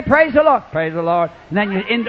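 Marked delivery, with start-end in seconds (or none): 0.7-1.3 grudging mumble. Retold or imitated. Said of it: "Everybody say".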